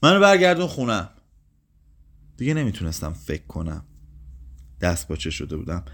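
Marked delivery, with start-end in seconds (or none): 0.0-1.1 loud, high demanding cry; raised voice, pitched well up; old woman crying out. A narrator's reading. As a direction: shout it as a desperate demand. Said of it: "پیرزن باز هم فریاد کرد".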